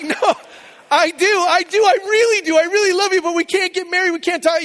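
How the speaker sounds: high, loud, frantic retold protest; hammed-up flustered dad backpedalling; panicked. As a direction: scramble to reassure, voice shooting way up. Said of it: "I'm panicked".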